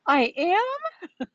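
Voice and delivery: high, loud squeak of disbelief; delighted surprise. Retold or imitated. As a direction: imitate her stunned, thrilled reply. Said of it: "And she was like".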